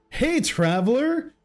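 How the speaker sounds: high, slow drawn-out greeting, mildly silly; lightly put-on high game-companion voice; a first, restrained go at a silly high voice; Paimon. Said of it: "I gotta get more silly".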